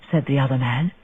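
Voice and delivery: ordinary delivery, nothing marked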